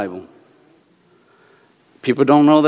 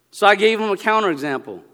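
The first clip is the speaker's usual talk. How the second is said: ordinary talk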